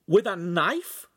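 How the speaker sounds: high, slow, horrified question; boy hears his friend was cut, horrified. A narrator's reading. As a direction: recoil at the thought of the knife.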